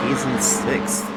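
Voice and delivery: ordinary talk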